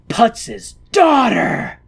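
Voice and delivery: slow loud high shout; dramatic reveal. Pretend. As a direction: shout the twist like a soap opera.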